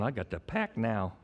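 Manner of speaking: quiet, low mock congregant; imitated sarcasm. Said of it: "a lot of that sarcasm is coming out".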